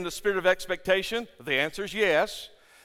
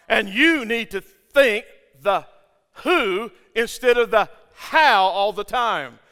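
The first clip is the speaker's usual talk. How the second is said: slow emphatic point; 4 semitones higher, 8 dB louder, slower than usual. Real, not acted.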